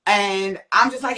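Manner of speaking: ordinary talk, nothing marked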